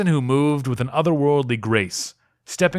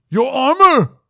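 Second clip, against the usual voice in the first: very high, loud cry; urgent shout; 8 dB louder, slower than usual. Acted. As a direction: yell at him to get his armor.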